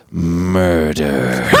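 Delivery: low, drawn-out spooky delivery; mock ominous. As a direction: draw it out low for a melodramatic punchline. Mock-ominous story punchline.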